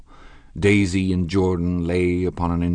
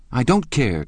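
higher, louder defiant cry; cried out; 8 semitones higher, 6 dB louder than usual. A narrator's reading. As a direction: toss it off defiantly. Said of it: "cried Daisy".

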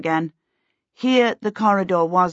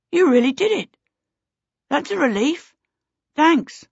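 put-on boy character voice; 8 semitones higher than usual. A narrator's reading. Peter relieved, voiced by narrator.

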